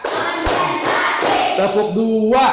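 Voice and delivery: rally the pupils with clap calls; energizer game leader. Real, not acted.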